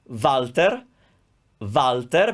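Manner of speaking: "Walter" with a v at the start; Italian pronunciation. Shown as a demonstration. Teacher showing a name spelled with W, "Walter" as "Valter". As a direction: say "Walter" as "Valter", r lightly sounded. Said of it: "This one we pronounce Walter".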